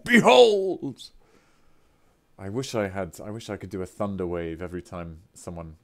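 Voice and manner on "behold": booming high proclamation, drawn out; theatrical announcer. Acted. Streamer hails a new subscriber like a herald, booming. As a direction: announce each gift like a herald.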